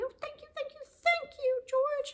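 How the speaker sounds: put-on very high, childlike squeal of thanks; high-pitched Jack voice. Jack thanking George in a tiny high voice.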